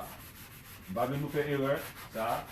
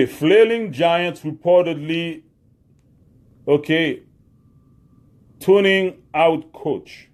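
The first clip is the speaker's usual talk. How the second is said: slow headline read; 4 semitones higher, 9 dB louder, slower than usual. Shown as a demonstration. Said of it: "reading headline".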